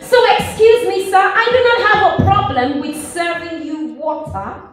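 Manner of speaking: high, insistent, repeated protest; impassioned objection to a stranger; emphatic protest. Acted. Protests to the man at the well.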